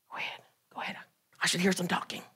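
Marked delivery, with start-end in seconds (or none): 0.1-1.0 hushed urging whisper; unvoiced go-ahead. Real, not acted. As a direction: breathe it under the noise. Urging the crowd to talk.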